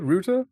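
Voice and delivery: Ruta said as ROO-tuh, rising; British ruta. Shown as a demonstration. Streamer reading chat, saying it the British way. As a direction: say Ruta with a long oo. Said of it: "I do say it weird".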